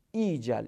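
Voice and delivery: "ایجل" said "ee-jal"; long-vowel reading. Shown as a demonstration. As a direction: open "ایجل" on a long ee, with no v or glide. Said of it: "اینطوری بخونیم".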